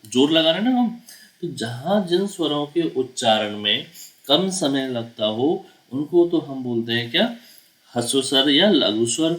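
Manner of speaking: ordinary talk, nothing marked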